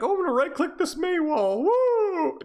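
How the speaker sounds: high squeaky mock-excited kid voice. Coach mocking the player's careless excitement, high and squeaky. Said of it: "Now you're like".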